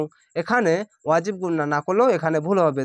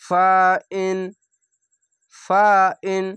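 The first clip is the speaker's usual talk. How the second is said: slow, drawn-out chanted recitation; Quranic recitation; 4 dB louder, much slower than usual. Shown as a demonstration. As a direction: recite each word slowly with tajweed. Recited demo.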